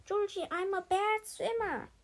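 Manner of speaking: high, loud overdone plea; faking trouble to get rescued; mock distress. A narrator's reading. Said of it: "Sage cried out again".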